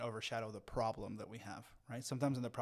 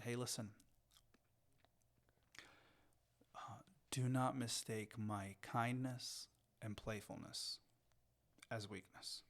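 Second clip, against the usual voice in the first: quiet, calm warning; 5 dB quieter than usual. Retold or imitated. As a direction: retell a father's calm, firm warning. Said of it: "got really quiet".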